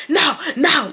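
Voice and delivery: high, mild mock yell; retold scream at half volume. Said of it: "Like, he starts screaming".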